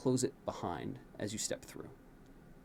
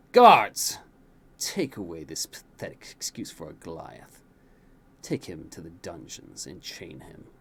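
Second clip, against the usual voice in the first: a loud bark then low sneering orders; spat command; 7 semitones lower, 5 dB louder than usual. A narrator's reading. New king ordering guards, spitting contempt. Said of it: "he spits".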